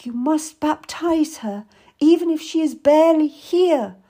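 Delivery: high, desperate insistence; loud, strained plea from a suffering mother; gasped urgency. A narrator's reading. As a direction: urge it as a desperate last request. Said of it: "I gasped".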